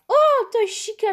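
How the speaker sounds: high, loud squeal of recognition; excited cry. Real, not acted. Player spotting Toy Chica she wants to hug, excited cry.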